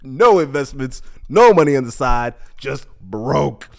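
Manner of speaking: emphatic. Real, not acted. Stressing being broke.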